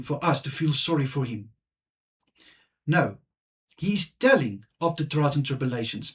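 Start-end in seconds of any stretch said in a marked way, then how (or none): none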